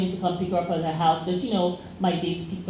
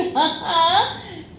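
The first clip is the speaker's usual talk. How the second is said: laugh; 10 semitones higher than usual. Real, not acted. Laughing at her own story.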